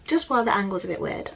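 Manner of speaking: ordinary talk, nothing marked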